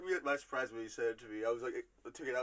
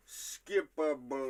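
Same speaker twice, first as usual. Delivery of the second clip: slow quoted line; 5 dB louder, much slower than usual. Shown as a demonstration. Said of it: "I'm gonna give it to you guys one more time".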